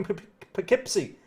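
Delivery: false starts on "Poughkeepsie", then the whole word; real tongue-tied stutter into "Poughkeepsie"; tripping over pronunciation. Real, not acted. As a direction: stumble on the first sound of "Poughkeepsie" before getting it out. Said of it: "Can't even pronounce it today".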